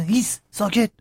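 lightly imitated mother hushing children; retold shushing.

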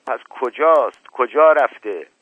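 exasperated master interrogating his servant; agitated demand. Acted. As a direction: demand an answer, hitting each question hard. Agitated uncle shakes servant, demanding.

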